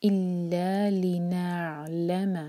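इल्ला लिनअलम slowly with the लाम pressed; stress on लाम. Shown as a demonstration. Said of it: "लाम पर जोर".

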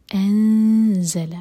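long, drawn-out recited انزل, loud and slow; chanted tajweed recitation; slow Quran recitation. Shown as a demonstration. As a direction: recite انزل slowly with full voice. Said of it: "empty نون کے بعد ہے زا".

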